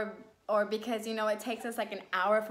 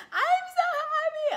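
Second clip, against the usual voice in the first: mock joyful wail, high and loud; squealed, tearful-sounding joy; happy crying; 6 dB louder than usual. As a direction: imitate bawling with happiness. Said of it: "and I'm crying".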